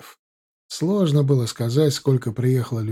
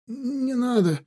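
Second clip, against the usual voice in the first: high pleading moan; 10 semitones higher, 4 dB quieter, slower than usual. A narrator's reading. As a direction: beg for your life. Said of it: "простонал раненый".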